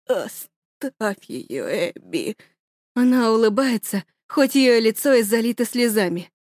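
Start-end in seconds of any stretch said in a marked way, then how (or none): 0.1-2.4 much softer, slow, breathy dying father; father gasping last orders to daughter; dying whisper. Acted. (тихо, шёпотом, умирая)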